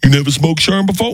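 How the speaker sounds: a hint of Tracy, rising question; Tracy Morgan impression. Tracy teasing the young comic, lightly voiced. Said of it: "Tracy Morgan says".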